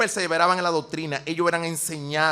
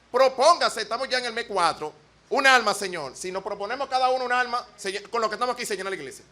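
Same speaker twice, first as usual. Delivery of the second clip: ordinary talk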